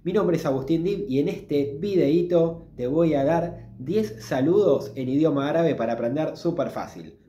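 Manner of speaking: ordinary delivery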